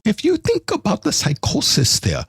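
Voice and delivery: put-on high-pitched hammy German accent; comically poor German-accented therapist; Doctor Ruth. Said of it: "That was the worst Doctor Ruth".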